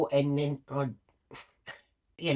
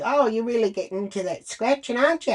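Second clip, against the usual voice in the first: mock ranger; 7 semitones higher, 3 dB louder, faster than usual. Ranger speaking to her, mocked.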